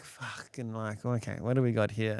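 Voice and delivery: quietly assess the damage, retold; contained panic. Trying to assess calmly, retold.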